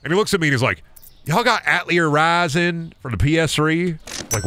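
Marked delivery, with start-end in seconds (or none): 1.2-4.0 imitated Southern farmer, pitched up; Southern accent. Farmer asks GameStop clerk for a game, imitated Southern accent. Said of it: "the funniest Southern accent encounter".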